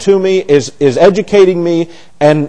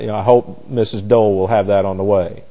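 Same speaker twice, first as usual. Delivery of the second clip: usual speaking voice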